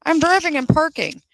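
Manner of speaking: high, clueless mock of the driver. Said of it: "told the judge".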